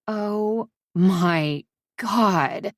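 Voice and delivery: slow stunned gasp of words. Acted. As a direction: drag it out in disbelief. Friend absorbing shock.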